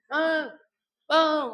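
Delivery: high, loud holler for a husband; demonstrated rude yell; yelled call. Shown as a demonstration. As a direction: mimic shouting for a spouse from afar. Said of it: "teriak-teriak".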